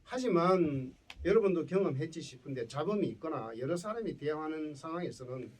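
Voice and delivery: normal talking voice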